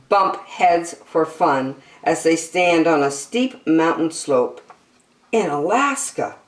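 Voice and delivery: bright, lilting picture-book read-aloud; reading to children. Acted. Reading an animal picture book to young children, bright and lilting. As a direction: read it like a bedtime nature book for kids.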